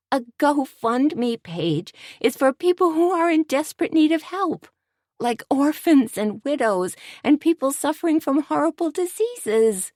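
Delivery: high, incredulous scolding voice; shrill disbelief after laughing fit; exasperated Tony. A narrator's reading. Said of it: "hysterical laughter... choking spasms".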